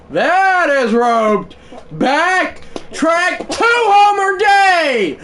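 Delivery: very high, loud, drawn-out home run call; shouted sportscaster call; play-by-play call. Acted. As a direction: call it like the big homer on TV.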